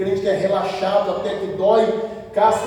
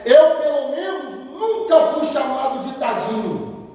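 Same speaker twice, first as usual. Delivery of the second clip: ordinary talk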